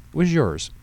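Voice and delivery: triumphant daughter's accusation; accusing. Acted. Daughter turns blame on father.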